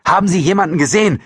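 quick, loud, high question; urgent call. A narrator's reading. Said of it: "rief Franziskowitsch".

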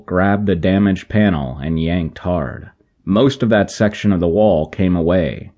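ordinary delivery, nothing marked

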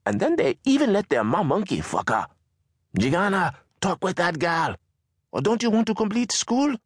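high, contemptuous mother's voice; a mother scolding her son to mind his sister; scornful Mama. Acted. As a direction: spit out scorn for the stingy tourists.

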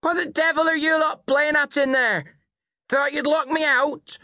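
high-pitched angry shouting; loud, angry woman. A narrator's reading. Drunken mother locked out of the house, loud and angry. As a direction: rage through the locked door. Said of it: "loud and angry".

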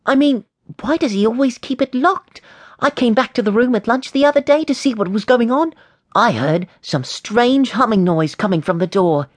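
high-pitched eager schoolboy; curious boy James, suspicious. A narrator's reading. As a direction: press the mystery in a high boy's voice.

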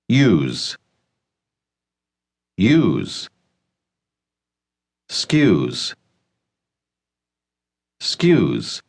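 isolated syllables built backwards into the phrase, long pauses between. Shown as a demonstration. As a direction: model each piece separately for learners to repeat. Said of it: "va a repetir esta expresión sílaba por sílaba". (sílaba por sílaba, empezando por la última)

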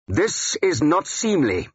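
slow, high, indignant rebuke; angry. A narrator's reading. King rebuking a man who disrupts prayer, angry. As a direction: rebuke angrily, drawing out the words. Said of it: "Alfred said angrily".

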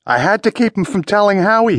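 high, desperate outcry; frightened cry. A narrator's reading. Wounded man defending his killing to Steve, crying out. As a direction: plead in pain and fright, pitch leaping up. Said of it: "he cried out".

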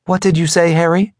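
high voice of a questioning wife; wife's voice. A narrator's reading. Wife's voice in narrated dialogue.

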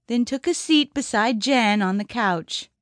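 usual speaking voice

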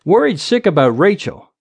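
ordinary delivery

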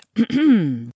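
pointed, acted throat clear; polite ahem before an announcement. A narrator's reading. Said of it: "Whimsy cleared his throat".

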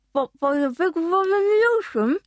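words mumbled around a teaspoon held in the mouth; spoon in mouth. Acted. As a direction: say it with a spoon lodged between your teeth. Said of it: "through a teaspoon lodged in her mouth".

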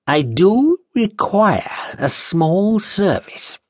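slow, sly sinister request; sinister slow master. Acted. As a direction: draw it out menacingly. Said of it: "he said".

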